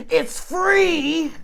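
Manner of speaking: shrill high yell right in his face; screaming. Retold or imitated. Big lady at the bus stop screams in his face. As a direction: scream it at him, outraged. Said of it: "some big fat lady screams in your face".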